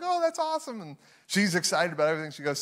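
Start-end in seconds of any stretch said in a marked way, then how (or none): 0.0-0.8 high, mild mock delight; a hint of the thrilled wife; lightly voiced delighted wife. Wife delighted by elf toy.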